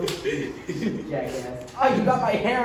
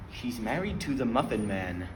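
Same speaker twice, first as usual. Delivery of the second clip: low voice; 9 semitones lower, 7 dB quieter than usual. Acted. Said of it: "That's too slow".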